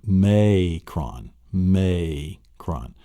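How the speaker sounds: "Macron" split into "MAY... cron"; long A, syllables apart. Shown as a demonstration. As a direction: hold the long A in "may", then drop "cron". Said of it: "It's the long A sound".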